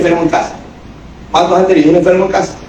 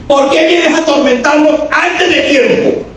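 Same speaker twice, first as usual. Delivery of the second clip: screaming demon; 11 semitones higher, slower than usual. Acted.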